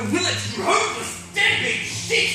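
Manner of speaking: high, loud, angry insult; furious tirade. Acted. Son berates his father on the phone, furious. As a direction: spit it at him, pitch soaring.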